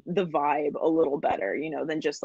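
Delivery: ordinary delivery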